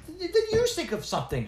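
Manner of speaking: put-on girl snapping at the dwarf. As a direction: snap back at him. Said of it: "she snapped".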